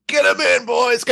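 very high, excited mock call; imitated call-out. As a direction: imitate a cop whooping them inside. Imitates cops calling them in.

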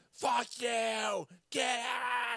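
high mock yell; retold screaming. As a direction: scream at her to get out. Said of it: "I start screaming".

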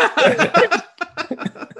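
loud high burst of laughter; hearty laugh. Real, not acted. Narrator laughs hard at the retold dare.

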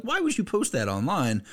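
high-pitched baffled question; retold disbelief, pitched well up; incredulous reaction. Reacting to a girl filming her own fall, mock incredulous.

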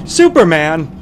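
loud, shocked exclamation; startled cry. Acted. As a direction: yelp the name in alarm. Mobster startled by Superman.

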